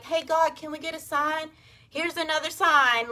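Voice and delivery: lightly mock the oblivious asking for a sign; mocking clueless people. Us clueless humans begging God for a sign, mock whiny voice.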